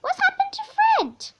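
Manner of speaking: a high plaintive cat voice; worried cat. A narrator's reading. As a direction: ask it like a fretting cat, up high. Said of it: "And often he said".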